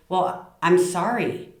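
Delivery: slow, grudging "sorry"; insincere apology. Shown as a demonstration. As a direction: apologize without meaning it. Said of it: "doesn't mean the same thing".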